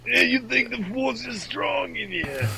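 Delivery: high, slow cartoon alien voice; drawn-out, high-pitched New York-accented creature; Watto. Star Wars alien boasting of his lightsaber, high slow voice. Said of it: "Watto should have been the Phantom Menace".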